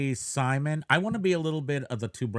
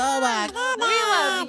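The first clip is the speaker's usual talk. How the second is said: squealing delight; 4 dB louder, much slower than usual. Real, not acted. Reacting to super chat.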